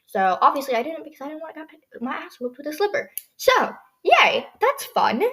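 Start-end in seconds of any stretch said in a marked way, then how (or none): 3.4-5.2 high, loud fake "yay"; mock-cheerful, dripping sarcasm; sarcastic cheer. Real, not acted. Sarcastic about slipper threat.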